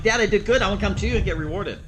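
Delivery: be the proud dog rushing to Dad; excited dog voice. Acted. Trainer voices the dog calling handler.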